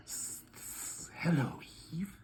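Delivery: whispered snake hiss, drawn-out s; Satan the snake whispering to Eve; hissing serpent. Acted. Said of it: "that old crafty snake, Satan".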